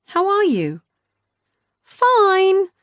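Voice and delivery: high, loud sing-song; bright sing-song sister voice; sing-song Beth. A narrator's reading. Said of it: "said Beth in her sing-song voice".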